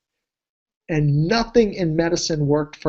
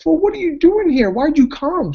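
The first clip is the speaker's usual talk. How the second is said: much higher, baffled question; host puzzled why she came; surprised; 11 semitones higher, 4 dB louder than usual. Retold or imitated. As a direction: act taken aback, pitched way up. Surprised reply to upset client.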